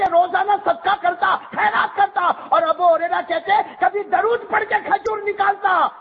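a preacher crying out to the congregation about charity; full-force preaching. Acted. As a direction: build the climax and shout it out. Sermon climax, shouted.

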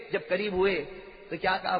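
ordinary talk

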